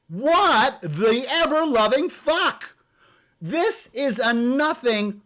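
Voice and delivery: slow, high outraged disbelief. Real, not acted. Reacting to the essay, in slow outraged disbelief. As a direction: let the outrage stretch out slowly.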